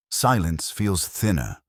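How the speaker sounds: plain reading voice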